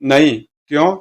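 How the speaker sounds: ordinary delivery, nothing marked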